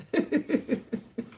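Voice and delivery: airy wheezing laugh, high up; high breathless laugh. Real, not acted. Vlogger laughing off a rough day online, airy wheeze.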